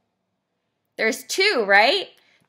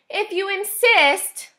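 high, slow, sweet ladybug voice; sugary storybook politeness; sweetly; 10 semitones higher, slower than usual. A narrator's reading. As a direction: agree sweetly, pitched well up and unhurried. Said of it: "answered the friendly ladybug sweetly".

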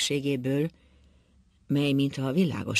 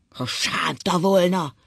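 slow, loud, higher roar; bellowing; 4 semitones higher, 5 dB louder, slower than usual. A narrator's reading. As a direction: bellow it out word by word. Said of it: "ordított Ákos". (ordítva)

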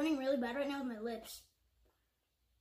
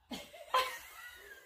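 high giggle. Real, not acted. Reacting to big piece.

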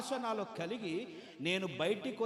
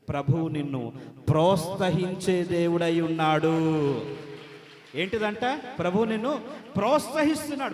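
preaching at full force; 10 dB louder than usual. Acted. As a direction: fire up the congregation. Preacher shouts encouragement.

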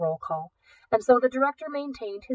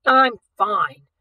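dismissive; 8 dB louder than usual. A narrator's reading. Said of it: "with a dismissive wave".

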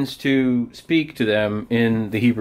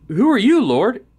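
high, louder awed question; startled question; 9 semitones higher, 4 dB louder than usual. Acted. Paul asking the voice from heaven who it is, startled. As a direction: call up high to an unseen Lord.

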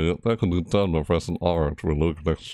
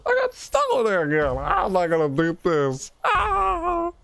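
frustrated screaming. Real, not acted. Gamer stuck, dying repeatedly.

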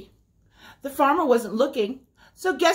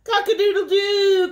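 very high, loud crowing; rooster crow; 10 semitones higher, 6 dB louder than usual. Acted. Rooster calling out to the spider, high loud crow. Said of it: "crowed the rooster".

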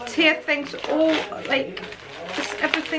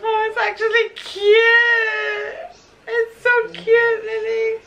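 higher, louder, laughing with glee; delighted squeal; 7 semitones higher, 4 dB louder than usual. Real, not acted. Finding the mouse carrying wine, gleeful laughing squeal. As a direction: gush over it, laughing.

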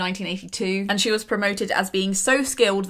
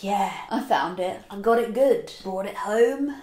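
hunter instinct. Retold or imitated. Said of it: "This is when my hunter instinct comes out".

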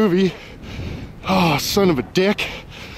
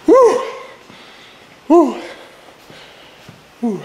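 loud, high whoops; happy whoops; 11 semitones higher, 5 dB louder, slower than usual. Real, not acted. Celebrating the warm room with loud, high whoops. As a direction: whoop with delight.

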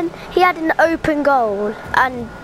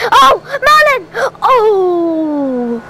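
anguished cry; 4 semitones higher, 6 dB louder, much slower than usual. Real, not acted. Missed pass to teammate Merlin.

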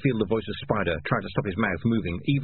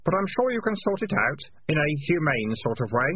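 lightly voiced boy, pitched up; slightly raised, mock-helpful voice; falsely reassuring boy; 7 semitones higher than usual. A narrator's reading. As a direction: reassure them with a sting in it.

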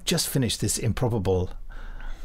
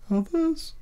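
much higher put-on voice; high mock query. Host mimics a character's question. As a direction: ask it in a thin high voice.

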